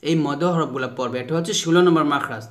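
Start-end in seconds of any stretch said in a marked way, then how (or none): none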